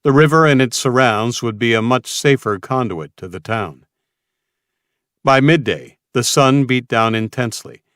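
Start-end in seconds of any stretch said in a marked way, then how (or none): none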